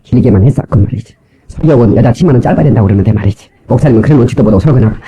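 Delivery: low, unimpressed mock complaint; grumbling critic. Churchgoer complaining about the sermon, low grumble. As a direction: imitate a disgruntled listener. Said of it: "그게 설교라고 하는 것입니다".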